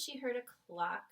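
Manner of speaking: ordinary delivery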